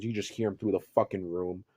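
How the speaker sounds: normal talking voice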